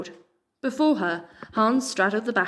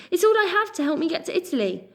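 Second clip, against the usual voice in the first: desperate; 10 semitones higher than usual. A narrator's reading. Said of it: "Pip said desperately".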